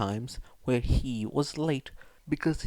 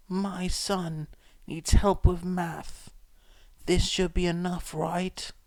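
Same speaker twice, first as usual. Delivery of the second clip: higher, slow, hopeful mother's voice; a poor mother asking a boy to tutor her son; scarred woman, pleading; 7 semitones higher than usual. A narrator's reading.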